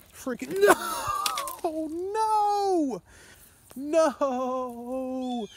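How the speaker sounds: high, drawn-out cries of no as the fish gets away; loud real frustration, pitch way up; anguished shout. Real, not acted. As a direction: yell it like you just lost the fish. Fish lost at bad hookset.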